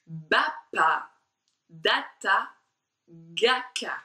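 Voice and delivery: Bapa, data, ga, ka crisply articulated, lips then palate then throat; exaggerated syllables. Shown as a demonstration. Teacher demonstrating exaggerated syllables to the class. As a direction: spit out each Bapa and data syllable sharply. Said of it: "écoutez bien".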